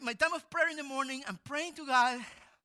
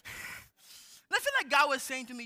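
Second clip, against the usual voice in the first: ordinary talk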